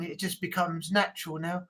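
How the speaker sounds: usual speaking voice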